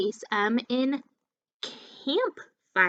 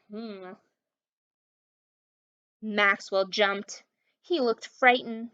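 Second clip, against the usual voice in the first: ordinary delivery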